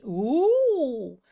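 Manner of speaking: long, loud swooping ooh; delighted ooh. Real, not acted. Host watching the screen text change, delighted swooping ooh. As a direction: swoop up and down in playful wonder.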